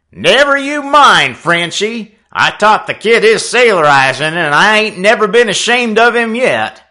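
a hint of a gruff old sailor; lightly voiced rough seaman. A narrator's reading. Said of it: "the gruff voice of Red Nelson".

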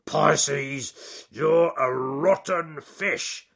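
loud, slow bear growl; grumpy bear. Acted. Bitten bear scolds the pike, loud and slow. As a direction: drag it out like a cross bear.

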